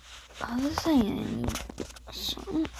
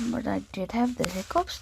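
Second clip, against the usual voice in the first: ordinary delivery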